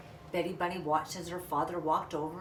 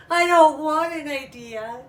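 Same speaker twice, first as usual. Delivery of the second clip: higher, louder tearful wail, slow; through tears; 7 semitones higher, 13 dB louder than usual. Acted. Little bunny crying to her parents, loud tearful wail. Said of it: "she said through her tears".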